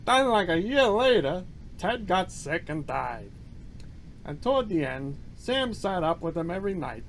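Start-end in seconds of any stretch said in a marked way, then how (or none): none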